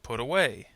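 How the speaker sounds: "Put away" with the beat on the WAY of away; stress on particle. Shown as a demonstration. Teacher gives phrasal verb example, "Put away" stressed on aWAY. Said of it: "on the particle of the phrasal verb".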